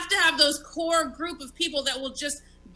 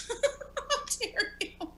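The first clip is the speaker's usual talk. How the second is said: laughing mock outrage; 7 semitones higher, 5 dB quieter, much slower than usual. Mock offended after compliment.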